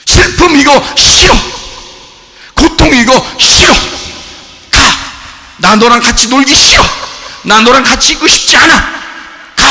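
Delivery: loud, high, punchy refusals; defiant acted yelling; shouted rejection. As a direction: shout it away in short bursts. Acting out rejection of sorrow.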